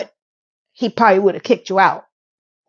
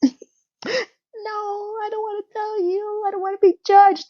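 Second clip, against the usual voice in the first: mock crying whine; 12 semitones higher, 5 dB quieter than usual. Mocking Miranda refusing to tell her boyfriend, in a high fake sob.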